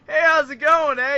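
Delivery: high, loud put-on Canadian greeting. Caller greeting the artist on Skype, hammed-up Canadian accent. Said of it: "'cause, uh, the Canadian part".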